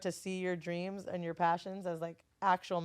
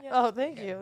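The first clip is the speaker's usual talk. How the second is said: normal talking voice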